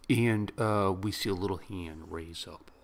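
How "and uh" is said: normal talking voice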